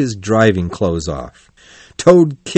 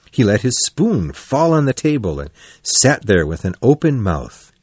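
ordinary delivery

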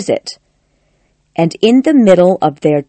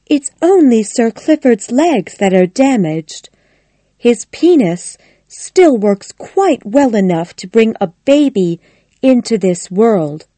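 higher-pitched lady of the house; Connie, insistent; 5 semitones higher than usual. Acted. Connie lying to Missus Bolton about Clifford, higher insistent lady's voice. Character's line in narration.

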